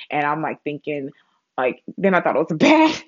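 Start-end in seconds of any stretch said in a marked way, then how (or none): none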